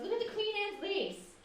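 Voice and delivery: high, affected snooty voice; imitating a pretentious sister, pitched high. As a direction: put on airs, lilting up high. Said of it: "she's very pretentious".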